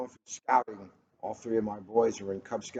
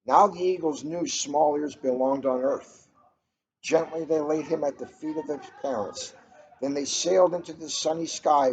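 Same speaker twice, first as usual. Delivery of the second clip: measured storybook reading, a bit brighter and louder than chat; story narration; 3 semitones higher, 5 dB louder than usual. A narrator's reading. Host reading a picture book to listeners, storybook narration. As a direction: read the tale aloud to listeners, carefully paced.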